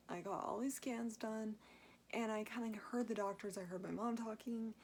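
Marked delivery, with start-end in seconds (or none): none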